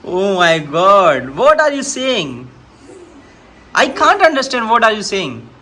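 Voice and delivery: very slow, drawn-out amazed English; mock astonishment. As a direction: stretch the words out in playful disbelief. (बेटे की अंग्रेज़ी पर बनावटी हैरानी)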